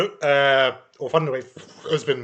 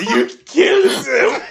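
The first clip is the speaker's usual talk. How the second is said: shocked laughing shout; 7 dB louder than usual. Real, not acted. Shocked at mushroom being picked.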